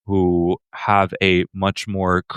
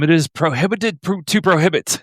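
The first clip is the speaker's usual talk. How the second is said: high-pitched imitation of a scandalized objector; mock official objection; 10 semitones higher than usual. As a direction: quote the ban in a silly high voice. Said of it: "the response was".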